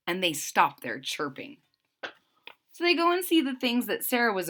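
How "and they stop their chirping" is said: ordinary delivery, nothing marked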